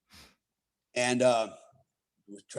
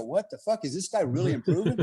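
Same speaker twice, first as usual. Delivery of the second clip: instructor's disbelief; 4 semitones higher than usual. Retold or imitated. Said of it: "watch me go".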